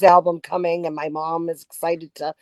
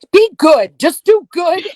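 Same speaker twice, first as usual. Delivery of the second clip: rising, louder plea to be good; fervent urging; 10 semitones higher, 10 dB louder than usual. Real, not acted. Fan urging the host's audience to do good, fervently. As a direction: press the message home with fervour.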